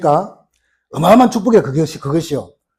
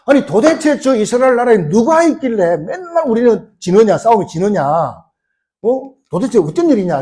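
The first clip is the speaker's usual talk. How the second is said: high, exasperated king's outburst; angry king; 5 semitones higher, 3 dB louder than usual. Acted. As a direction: act out a king demanding answers, pitch raised. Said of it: "화가 난 거예요".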